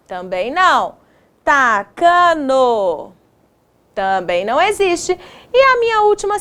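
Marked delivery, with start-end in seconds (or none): 1.4-3.1 "Tacano" very slow, each syllable stretched; sounding out. Shown as a demonstration. Teacher testing made-up word.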